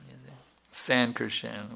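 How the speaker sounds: usual speaking voice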